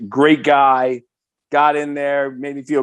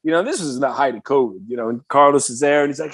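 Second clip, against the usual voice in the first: normal talking voice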